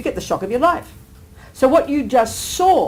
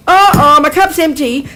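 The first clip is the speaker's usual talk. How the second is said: loud, high hammed-up oh oh; playful mock alarm; mock dismay; 5 semitones higher, 8 dB louder than usual. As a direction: exaggerate the surprise at the empty cup. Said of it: "So we would say".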